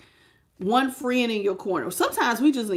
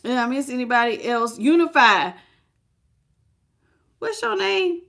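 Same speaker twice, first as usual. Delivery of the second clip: ordinary talk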